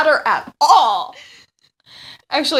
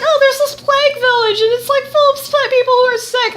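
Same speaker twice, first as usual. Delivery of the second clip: high, eager imitation; mock Alicia voice; 10 semitones higher, 3 dB louder than usual. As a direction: chirp it like a cheerful game heroine.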